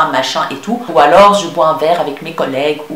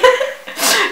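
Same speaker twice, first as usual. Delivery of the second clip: high giggle; 3 dB quieter than usual. Real, not acted. Laughing while reading viewer question.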